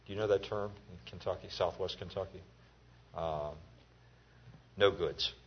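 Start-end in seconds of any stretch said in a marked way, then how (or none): none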